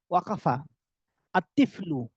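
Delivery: ordinary talk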